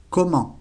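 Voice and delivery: comment with a silent final T, nasal ending; French comment. Shown as a demonstration. Teacher models comment for students, final T silent. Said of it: "La última T no se pronuncia".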